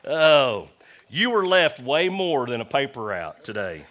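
high, loud preaching declaration; preaching shout. Real, not acted. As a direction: shout it to the church.

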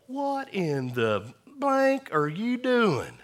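put-on high, slow, strained old-man holler; grumpy old man. Old golfer scolds slow players, high strained holler. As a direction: squeal it like a cranky old member.

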